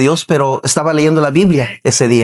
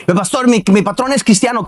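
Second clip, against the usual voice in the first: raised-pitch imitated objection; mock protest; 7 semitones higher than usual. Congregant objecting to the pastor about his boss, mock protest.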